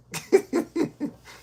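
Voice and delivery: very high, breathy laugh; real laugh. Real, not acted. Streamer reading a viewer's joke about his hair, giggling.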